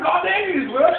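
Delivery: loud, high mock fury; indignant retort, voice shooting up; defiant outburst. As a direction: fire back in acted outrage. Acted quarrel over the price.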